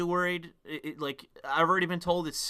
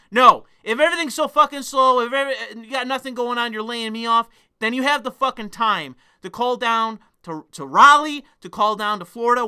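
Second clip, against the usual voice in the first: raised, forceful rant at HR; angry demand; 5 semitones higher, 6 dB louder than usual. Pretend. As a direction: rehearse telling off payroll, pitched up and insistent.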